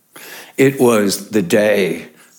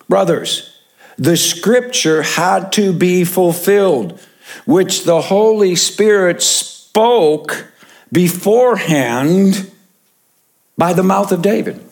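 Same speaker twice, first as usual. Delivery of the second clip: Peter addressing brothers; 7 semitones higher than usual. Retold or imitated. Preacher voices Peter.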